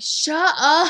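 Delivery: mock annoyed yell. Mom answers her screaming daughter, mock annoyed yell. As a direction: yell it like an exasperated parent, in imitation. Mom's yelled reply to screaming, retold.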